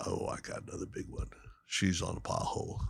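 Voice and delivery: low, fast, breathy hush while stalking fish; hushed whisper. Retold or imitated. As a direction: keep it down so the fish won't spook. Guide whispering spotting fish.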